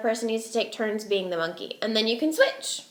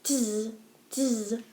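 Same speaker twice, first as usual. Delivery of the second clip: monkey chatter; 5 dB quieter, much slower than usual. Acted. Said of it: "shook their fingers back at him and said".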